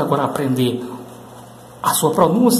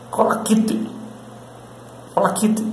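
American pronunciation; 3 semitones higher, 5 dB quieter, slower than usual. Shown as a demonstration. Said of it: "Falando esse sotaque aqui".

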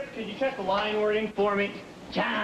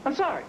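a higher "sorry" that falls; stress on sorry; 8 semitones higher, faster than usual. Shown as a demonstration. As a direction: lift "sorry" then drop it.

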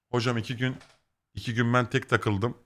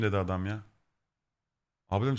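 ordinary talk

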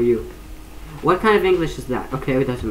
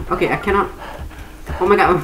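usual speaking voice

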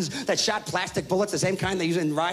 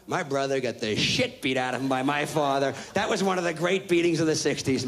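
ordinary delivery

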